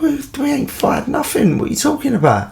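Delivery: high, squeaky protest of innocence; kid's high startled denial; indignant denial. Retold or imitated. Boys deny firing maggots at the boat, squeaky protest. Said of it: "we're like".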